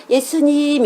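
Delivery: a call of 예수님 that stays stuck inside; held-back child's call. Retold or imitated. Children cannot shout by the river, held-back call. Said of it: "이래요".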